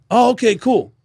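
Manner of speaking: high, easygoing dude voice; fan thanks. Retold or imitated. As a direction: play the laid-back metal fan. Fans thanking him.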